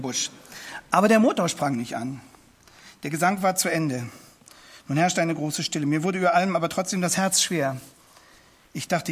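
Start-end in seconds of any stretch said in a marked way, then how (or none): none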